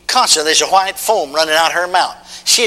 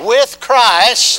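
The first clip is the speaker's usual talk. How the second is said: loud, high, very slow call for the crowd to repeat; preaching call; 6 semitones higher, 4 dB louder than usual. Acted. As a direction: chant it out line by line. Call and response.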